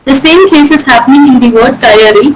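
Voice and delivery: ordinary talk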